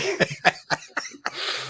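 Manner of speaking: a burst of high-pitched laughter; high squealing laugh. Real, not acted. Host cracking up at the kick rocks line, high laugh.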